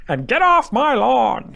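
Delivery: slow, high cranky geezer yell; old man voice. Pretend. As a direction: yell like a grumpy old man shooing kids. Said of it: "I think you got your old man voice out".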